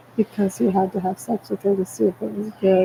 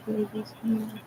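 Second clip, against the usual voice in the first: softly whispered call, much quieter; 2 semitones higher, 9 dB quieter than usual. Real, not acted. Calling the babies close, in a real whisper.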